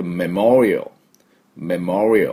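メモリアル as "meh-MOR-ee-uhl"; メモリアル in English, swelling on the second syllable; correct English pronunciation. Shown as a demonstration. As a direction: stress "MOR" in メモリアル and end on a dark l. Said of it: "ふうに発音します".

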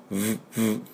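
short lip buzzes like a phone on vibrate; buzzing V sound. Shown as a demonstration. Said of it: "携帯バイブのように振動させます". (携帯バイブのように震えるVの音)

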